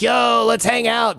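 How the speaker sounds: high, drawn-out buddy call; over-friendly greeting. Acted. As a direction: holler a slow, chummy invitation. Overfriendly call to a neighbor, acted.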